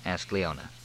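ordinary delivery, nothing marked

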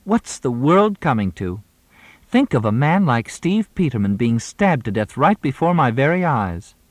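high, dramatic actress voice; overacted dismay; theatrical lament. A narrator's reading. Moxie's theatrical grief.

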